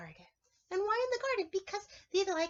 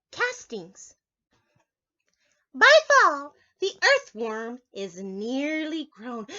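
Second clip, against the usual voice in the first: slow, bright storybook read-aloud; reading to children; 9 dB louder, slower than usual. Acted. Teacher reading a picture book to children, slow storybook voice. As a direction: read it out slowly for the little ones.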